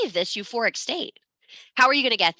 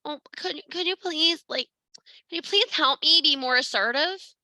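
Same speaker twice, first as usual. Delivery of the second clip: whiny plea; 8 semitones higher than usual. Pretend. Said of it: "and say".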